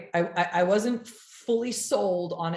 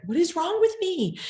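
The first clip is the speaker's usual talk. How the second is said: put-on child asking itself; 13 semitones higher, 4 dB louder than usual. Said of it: "I'd be like".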